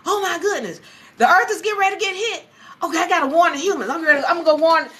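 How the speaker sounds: high-pitched, hurried alarm; imitating someone frantic to warn people; mock panic. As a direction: play up a rushed, high-voiced panic. Alarmed retold reaction to disaster.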